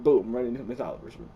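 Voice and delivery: ordinary talk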